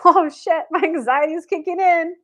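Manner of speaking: high, anxious imitation of nerves; nervous laughter. Anxious newcomer as her anxiety kicks in, mock nervous. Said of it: "had that nervous laughter".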